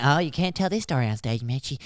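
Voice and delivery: a hint of a hushed, scolding in-law. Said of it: "they, they're like".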